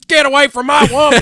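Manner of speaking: put-on loud, high old Western tough guy; old-timey actor voice. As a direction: shout it like a movie cowboy. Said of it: "it's better than".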